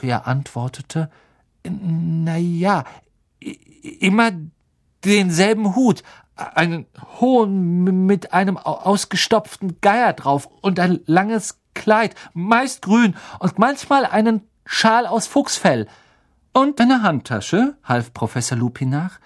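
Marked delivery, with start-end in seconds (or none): 1.6-15.9 put-on higher, halting boy's voice with stumbles; hesitant Neville. Shy pupil describes his grandmother's clothes to the teacher, halting. 16.5-17.8 raised, kindly teacher voice; a friendly professor coaxing a shy pupil; Professor Lupin voice. Acted. Teacher gently asks nervous Neville his fear, kindly raised voice.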